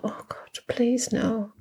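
voiceless, hushed inner plea; horrified whisper. Retold or imitated. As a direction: whisper it like a private dread. Recalling his horrified private thought.

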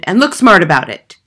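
brusque shouted order; Trunchbull's shout. A narrator's reading. Headmistress hurries a boy to the platform, shouting. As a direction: bellow it, impatient for obedience. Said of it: "the Trunchbull shouted".

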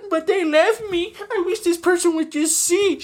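high, whiny fake crying; exaggerated high-pitched boo-hoo voice; mock crybaby commenter. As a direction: mimic a sobbing commenter, mockingly. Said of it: "the comment section will be like".

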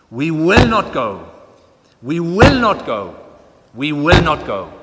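booming, slow Welsh preacher at full force; loud, lyrical Welsh preacher's proclamation; Lloyd-Jones hammering the pulpit. Retold or imitated. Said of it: "he sort of hammers the pulpit".

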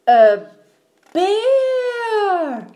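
loud, high, long-held cry; shocked shriek. Acted. Mom finally sees the bear, shrieking. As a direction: break off into a drawn-out scream.

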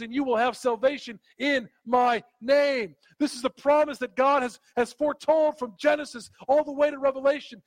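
loud bursts with rising, insistent pitch; emphatic pulpit proclamation; impassioned preaching. Real, not acted. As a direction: preach it at full force, driving each promise home. Preaches at full force.

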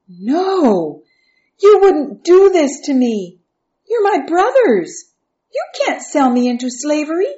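high, loud disbelieving plea; horrified protest. A narrator's reading. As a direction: beg your brothers, voice rising in alarm. Joseph realizes he is being sold.

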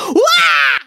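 sudden high shriek of alarm; duck scream. A narrator's reading. Mother duck shrieks at her ugly sixth duckling. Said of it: "she let out a scream".

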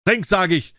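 high, forceful angry order; roared command. A narrator's reading. Tyrant orders the cripple to drink, angry high bellow. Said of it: "brüllte".

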